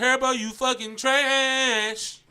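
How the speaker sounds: very loud, long drawn-out holler; full-volume heckle, extremely slow; yelling. Real, not acted. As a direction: yell it defiantly, dragging out the final word. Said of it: "You ain't finna yell that shit".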